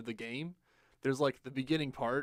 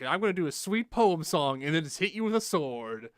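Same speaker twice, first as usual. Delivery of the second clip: higher, louder mock-poet orc; 4 semitones higher, 6 dB louder than usual. Poet orc announcing his attack to the player, hammed-up. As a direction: announce it grandly as a warrior poet. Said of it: "there's, like, the poet one".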